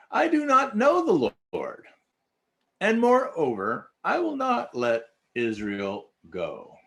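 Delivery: high-pitched, loud mocking pharaoh; booming pharaoh. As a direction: sneer it out as a haughty king. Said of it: "You hear it in Pharaoh's voice".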